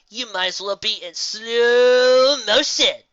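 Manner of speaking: stretched very slow and loud; mocking slow motion. Gilda mocks Dash's speed.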